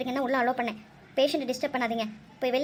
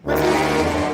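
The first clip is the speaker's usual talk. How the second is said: loud, low stunned echo of the name; shocked; 13 semitones lower, 9 dB louder, much slower than usual. Acted. Doctor hears the mother's name, shocked loud echo. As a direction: jolt at hearing Vasundhara.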